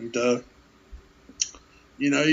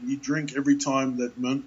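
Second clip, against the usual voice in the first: ordinary delivery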